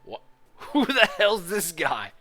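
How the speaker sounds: laughing, slow and loud, in disbelief; cracking up at the strange new guy. Real, not acted. Streamer laughing at the surprise character, loud disbelief.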